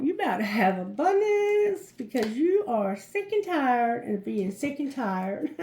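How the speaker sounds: ordinary talk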